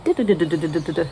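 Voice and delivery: quick, rattling du-du-du burst; playful mock sound effect, rapid; imitated scatter noise. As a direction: fire off the syllables fast like things flying everywhere. Emotions scattering everywhere, imitated.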